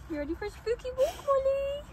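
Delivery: high, fast pet voice; talking to the dog. Real, not acted. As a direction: chirp at the dog to get her walking.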